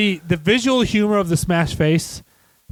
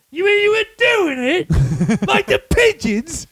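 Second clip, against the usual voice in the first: very high, slow mock astonishment; appalled character impression; 14 semitones higher, slower than usual. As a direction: draw it out, scandalised and squeaky. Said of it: "And he goes".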